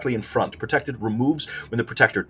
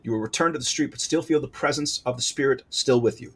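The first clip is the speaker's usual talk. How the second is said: ordinary delivery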